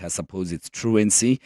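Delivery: ordinary talk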